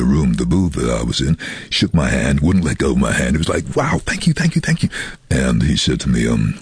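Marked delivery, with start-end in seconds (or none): 3.8-4.9 high gushing thank-yous; excited thanks. Retold or imitated. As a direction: gush with delight. Producer quoted, much higher.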